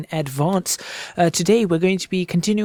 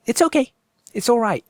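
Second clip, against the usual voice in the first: put-on a light, high-pitched try at a Welsh accent; brief Welsh lilt, pitched higher; mild Welsh accent; 8 semitones higher than usual. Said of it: "It's not really great at the moment".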